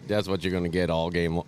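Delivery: usual speaking voice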